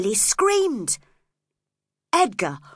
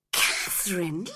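shocked. A narrator's reading. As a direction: breathe her name in disbelief. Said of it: "cried Edgar, shocked".

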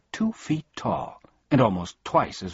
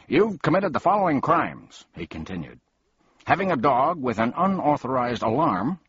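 stern policeman voice; 7 semitones higher, 3 dB louder than usual. A narrator's reading. Policeman lists crimes.

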